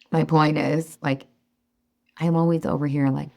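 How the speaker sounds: normal talking voice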